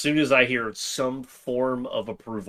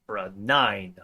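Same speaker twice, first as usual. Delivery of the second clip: ordinary talk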